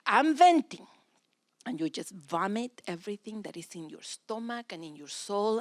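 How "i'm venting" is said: higher, louder "I'm venting."; a wife announcing to her husband, raised. Shown as a demonstration. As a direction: declare it to your spouse. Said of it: "Make sure you say it".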